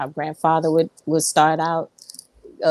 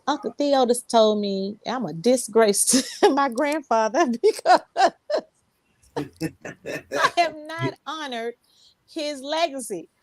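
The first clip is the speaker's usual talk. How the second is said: tearful; 7 semitones higher than usual. Retold or imitated. Retelling hurtful remark, crying.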